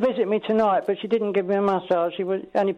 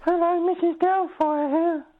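a higher, slow put-on old nanny voice; Mrs Doubtfire voice; 8 semitones higher, slower than usual. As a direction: stretch it out like a sweet old nanny. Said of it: "that's not a usual voice for Missus Doubtfire".

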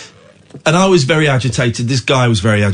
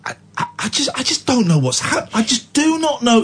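tearful recall; 11 semitones higher than usual. Real, not acted. Recounting being in tears.

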